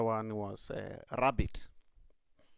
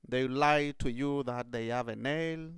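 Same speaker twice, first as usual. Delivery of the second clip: ordinary delivery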